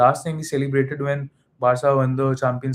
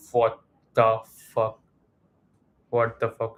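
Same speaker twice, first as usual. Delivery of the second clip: slow, word-by-word retold shock; stunned disbelief; 2 semitones lower, slower than usual. Fan reacting as Mbappe scored again, stunned disbelief. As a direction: spell out the disbelief, pausing before repeating it. Retelling shock at second equalizer.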